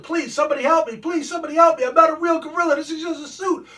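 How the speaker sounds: high, loud hammed-up cries for help; panicked screaming. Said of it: "He starts freaking out, screaming, yelling".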